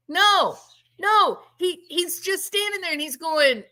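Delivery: normal talking voice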